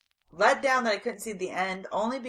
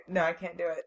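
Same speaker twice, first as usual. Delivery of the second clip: normal talking voice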